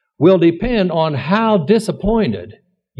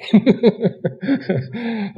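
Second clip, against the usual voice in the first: short amused laugh; 4 semitones higher, 3 dB quieter than usual. Real, not acted. Laughs at the boy's denial.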